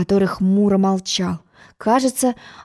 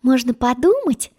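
ordinary delivery

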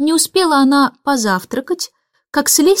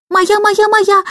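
put-on shrieking toddler; 10 semitones higher, slower than usual. A narrator's reading. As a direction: squeal it like a toddler grabbing a toy. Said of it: "кричала Барбара". (визгливо, по-детски кричать)

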